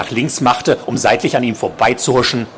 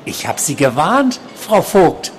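high-pitched, insistent warning voice; creepy stalker; 7 semitones higher than usual. A narrator's reading. Patient confronting his nurse at night, high creepy warning. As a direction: warn her in a high, uncanny voice.

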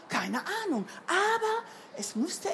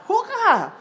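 loud, high cheer; joyful call; 8 semitones higher, 10 dB louder than usual. A narrator's reading. Mama joins Bruno's celebration, cheering loudly. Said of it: "da rief auch seine Mama".

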